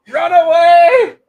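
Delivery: high, slow, loud mock cry of run away; Monty Python knight. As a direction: yell it like a fleeing Python knight. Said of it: "knight from Monty Python".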